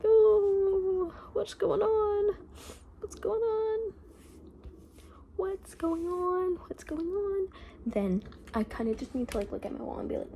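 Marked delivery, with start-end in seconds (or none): 0.0-7.5 high, drawn-out mock wail. Student seeing their grades and freaking out, mock wail. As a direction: whine it out slowly, hammed-up despair. Acts out panicking over bad grades.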